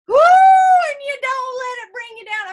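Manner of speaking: loud rousing pep talk; cheering shout. Real, not acted.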